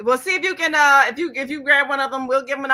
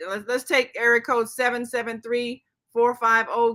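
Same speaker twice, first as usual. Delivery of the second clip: ordinary talk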